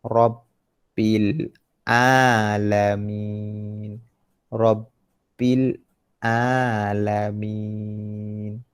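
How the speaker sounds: very slow, level Quran recitation; teacher's drawn-out model phrase. Shown as a demonstration. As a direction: recite with long held vowels.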